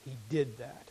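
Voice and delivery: ordinary delivery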